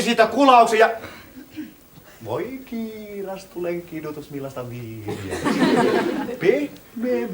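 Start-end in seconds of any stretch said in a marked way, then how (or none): 2.2-7.3 soft, reverent gasp of delight; quiet rapture over the wine; awed hush. Acted. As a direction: breathe it out in awe. Awed by the wine's taste.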